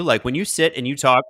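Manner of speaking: ordinary talk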